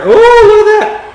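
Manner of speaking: high, loud surprised squeal; a shrill-free high cry of surprise at the dust cloud; startled squeal. Real, not acted. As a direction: yelp as the sugar cloud puffs up. Mixer blows out sugar cloud.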